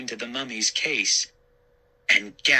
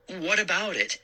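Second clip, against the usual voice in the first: demanding; 9 semitones higher than usual. A narrator's reading. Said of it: "I demanded".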